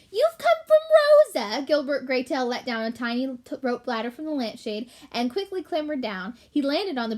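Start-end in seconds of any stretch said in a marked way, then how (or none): 0.1-1.6 high, slow squeak of a small rat; high rat voice, drawn out; squeaky rat voice. Acted. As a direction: pitch way up as the little rat. Rat voice realizes cousin's name.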